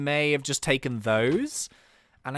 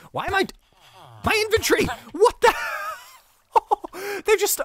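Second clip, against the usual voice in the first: stunned as everything vanishes; shocked disbelief; 13 semitones higher, faster than usual. Real, not acted. Inventory stolen, shocked.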